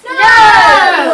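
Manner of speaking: loud, high shouted no. Acted. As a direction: yell the answer back. Chorus shouts the answer.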